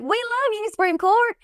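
high-pitched, hammed-up Texas twang; terrible Texas accent. Imagined Texas cheering the Supreme Court's ruling, terrible Texas accent. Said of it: "that's a terrible Texas accent".

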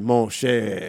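light mock French-Belgian accent; imitating Van Damme saying mon cher; a hint of Van Damme; Van Damme impression. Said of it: "Van Damme did that movie".

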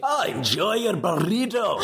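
slow Scottish groundskeeper read of the sign-off; Scottish accent. Pretend. Asked for a Scottish accent read of the podcast sign-off, hammed-up Scottish. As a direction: roll it out in a gruff Scottish brogue. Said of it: "a terrible Scottish accent read".

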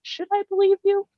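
high-pitched mock wondering; imagined doubtful reply. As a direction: question the villain in a high, put-on voice. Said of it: "I'd be like".

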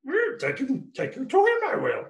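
very high-pitched puppet impression. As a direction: squeeze the voice up like a puppet. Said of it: "Fozzie Bear".

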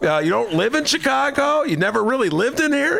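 high-pitched, befuddled voter voice; mock a clueless voter, way up high; befuddled Chicago voter. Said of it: "that's my Chicago voter accent".

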